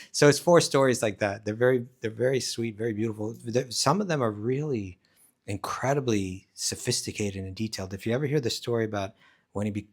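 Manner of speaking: usual speaking voice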